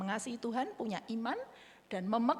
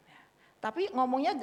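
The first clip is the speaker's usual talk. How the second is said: ordinary talk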